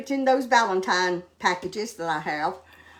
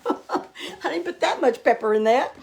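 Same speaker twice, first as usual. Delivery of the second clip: laughing through the words; 5 semitones higher than usual. Real, not acted. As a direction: chuckle at your own sneezing. Sneezing, laughs at herself.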